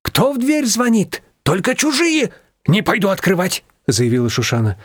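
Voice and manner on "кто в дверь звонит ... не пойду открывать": high-pitched, agitated exclamations; alarmed refusal. A narrator's reading. Shushana refusing to open to strangers, alarmed. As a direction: balk in a high, flustered voice.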